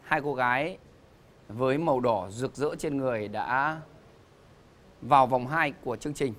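booming announcer introducing contestants; game show host. Acted. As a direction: present them to the studio audience.

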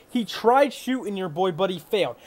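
ordinary delivery

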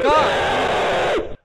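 jump-scared shout; startled shout. Real, not acted. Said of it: "That scared the shit out of me".

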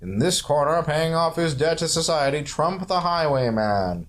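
ring announcer. Pretend. Announcing Trump the Highwayman to the co-player, mock ring announcer. Game announcer introducing the foe.

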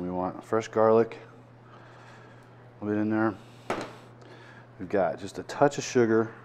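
ordinary talk, nothing marked

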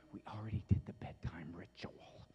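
breathy hushed whisper of the routine. Real, not acted. Dad whispering about the bedtime ritual.

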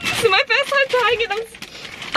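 high excited squeal; giddy excitement. Real, not acted. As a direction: squeak it out, thrilled. Thrilled first taste of Taco Bell.

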